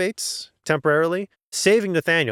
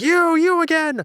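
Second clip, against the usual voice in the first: much higher, louder mock fury; furious; 4 dB louder than usual. Honorius recognizing the golem, mock furious. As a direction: spit out a hammed-up accusation. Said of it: "And he gets furious".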